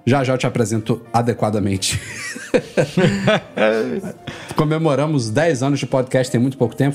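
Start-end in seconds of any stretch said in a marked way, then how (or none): 2.0-4.5 hearty laugh. Real, not acted. Host laughs at the guest.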